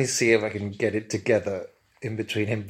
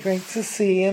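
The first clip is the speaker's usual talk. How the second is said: higher camp Scouse "Great to see you"; camp Liverpool accent; 8 semitones higher, 4 dB louder than usual. Acted. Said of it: "in his camp Liverpool accent".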